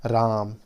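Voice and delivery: "राम" stretched out on a long आ; prolonged vowel. Shown as a demonstration. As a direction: take extra time over the vowel in "राम". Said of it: "उसमें थोड़ा अधिक समय लेने". ("राम" में आ को देर तक खींचकर)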